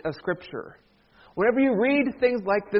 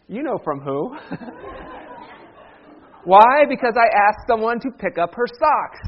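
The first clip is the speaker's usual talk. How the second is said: normal talking voice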